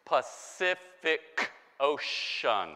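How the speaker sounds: "Pacific Ocean" slowly, each c sounded distinctly; drawn-out "Pacific Ocean" showing three c sounds; slow pronunciation demo. Shown as a demonstration. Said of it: "is pronounced differently".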